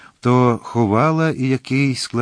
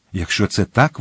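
plain reading voice

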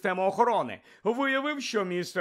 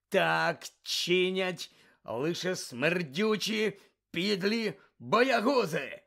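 slow contemptuous anger; 4 semitones higher, 4 dB louder, much slower than usual. Acted. Moody berating Malfoy.

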